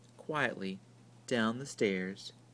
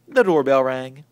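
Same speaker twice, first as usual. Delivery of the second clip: usual speaking voice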